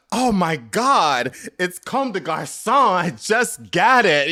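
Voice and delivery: slow, high, loud showing off of a designer piece; preening fashion guy. Retold or imitated. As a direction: drawl it out like a label snob. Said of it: "I was like that guy".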